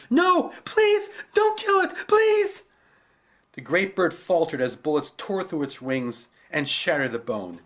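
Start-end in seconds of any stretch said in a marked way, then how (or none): 0.1-2.6 very high, loud desperate plea; girl screaming a plea; pleading. Acted. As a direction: beg him to stop.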